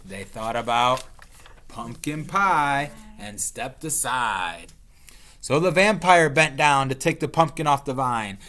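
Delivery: ordinary delivery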